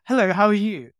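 bright, loud fake-friendly greeting; chirpy charity mugger hello; street fundraiser. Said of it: "be like".

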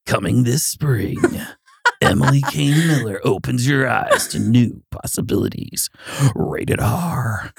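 slow, dramatic trailer announcer; hammed-up blockbuster promo read; movie trailer voice. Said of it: "That sounds like a movie trailer".

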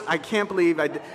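ordinary delivery

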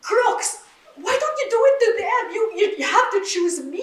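heated retold outburst, nearly crying; retold fury. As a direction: rage at God through a cracking half-laugh. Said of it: "So I was that angry".

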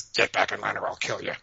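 a hint of a snarling pack leader; growling alpha wolf. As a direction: growl it lightly as a warning to a challenger. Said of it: "growls and snarls and says".

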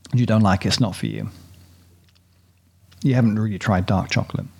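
ordinary talk

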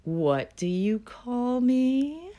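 high, slow and sugary sweet; cutesy voice. Retold or imitated. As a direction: coo it out, playfully sweet. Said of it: "in a cutesy voice".

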